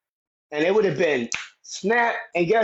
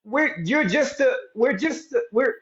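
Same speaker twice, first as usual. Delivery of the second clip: mock flustered, higher voice; flustered protest; 9 semitones higher than usual. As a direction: stammer out a protest, caught off guard. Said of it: "You say".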